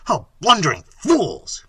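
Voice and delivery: loud, angry exclamation; prince's furious outburst; exasperated cry. A narrator's reading. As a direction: curse the bungling servants. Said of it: "cried Manfred".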